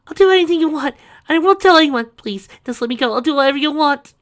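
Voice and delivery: high, loud desperate begging; pleading girl voice. Acted. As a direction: beg for your life.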